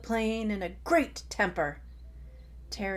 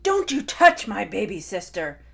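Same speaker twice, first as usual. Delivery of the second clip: loud protective roar; 11 dB louder than usual. Acted. Tai defends Terry from Spinosaurus, loud furious roar. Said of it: "Tai roared and raged".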